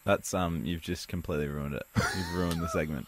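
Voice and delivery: hushed, let-down complaint; deflated. Real, not acted. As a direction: drop the volume right down, defeated. Deflated after segment is ruined.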